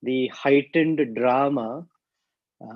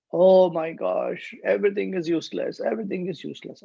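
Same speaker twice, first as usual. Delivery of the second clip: acted lament; 6 semitones higher, faster than usual. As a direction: wail it lightly, speeding up. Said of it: "why are you shouting like this".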